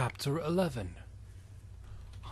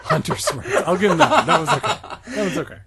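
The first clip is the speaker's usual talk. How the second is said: high, loud, laughing through words; 11 semitones higher, 8 dB louder, faster than usual. Real, not acted. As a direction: read while cracking up. Laughing while reading.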